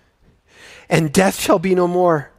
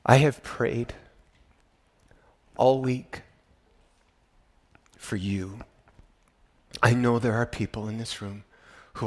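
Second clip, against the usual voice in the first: soft, low, unhurried assurance; hushed pastoral sincerity; tender reassurance; 3 semitones lower, 4 dB quieter than usual. Real, not acted. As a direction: lean in and comfort them gently.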